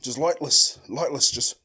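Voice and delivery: normal talking voice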